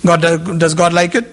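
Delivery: ordinary delivery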